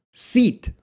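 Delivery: long tense ee in "Seat". Shown as a demonstration. Teacher saying "Seat" for a listening quiz. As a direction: stretch the ee in "Seat" wide. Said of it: "listen, which word do I say".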